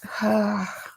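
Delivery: crying sigh breaking off; tearful sigh. Real, not acted. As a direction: let out a sob-like sigh. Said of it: "I did not mean to cry".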